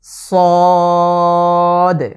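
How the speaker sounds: long, thick, full-voiced সদ; Arabic letter sad. Shown as a demonstration. Teacher demonstrating the letter to viewers, a loud drawn-out সদ. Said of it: "আবারও খেয়াল করুন".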